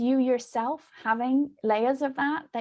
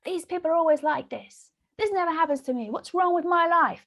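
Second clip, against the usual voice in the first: mock self-pity, pitched up; self-pitying whine; 6 semitones higher than usual. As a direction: imitate someone wallowing in pity, a bit higher. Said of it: "to feel sorry for myself".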